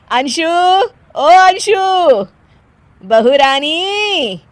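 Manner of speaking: high, loud, drawn-out summons; calling out. Acted. Mother-in-law summoning daughter-in-law, calling out slowly. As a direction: sing out the name across the house.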